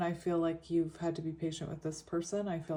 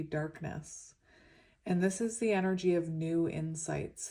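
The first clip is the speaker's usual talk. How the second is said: usual speaking voice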